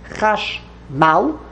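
chash, pause, mal; two separate syllables. Shown as a demonstration. Teacher returning to the original word, chash and mal apart. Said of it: "two syllables".

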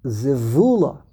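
zivula with VU stressed, final la falling away; stress toward the start. Shown as a demonstration. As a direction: punch the VU in zivula, let la drop. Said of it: "emphasis is on the beginning of the word".

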